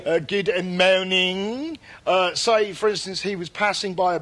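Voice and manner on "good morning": put-on very drawn-out French-accented "morning"; cod French accent. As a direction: stretch the mispronounced greeting in bad French accent. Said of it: "he pronounced words wrong".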